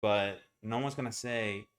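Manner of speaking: ordinary talk, nothing marked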